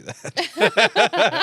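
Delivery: spoken through laughter, high and loud; laughing. Real, not acted. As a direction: laugh the words out. Laughing joke.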